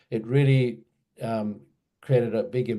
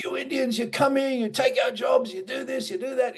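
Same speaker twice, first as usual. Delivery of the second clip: much higher, shouted rant in a put-on voice; 14 semitones higher than usual. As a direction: spit out the abuse like the angry old man. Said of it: "a tirade of abuse".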